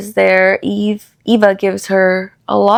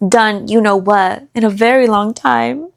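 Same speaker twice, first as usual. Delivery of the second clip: usual speaking voice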